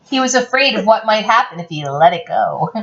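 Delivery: ordinary delivery, nothing marked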